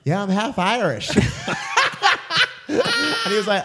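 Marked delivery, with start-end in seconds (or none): 0.0-1.1 put-on higher, laughing Irish accent. Explaining her changed accent to her date, Irish accent. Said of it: "slowly slip in the Irish accent". 1.1-2.6 high, loud burst of laughter; real laugh. Real, not acted. Laughing at her own disaster date story, real laugh.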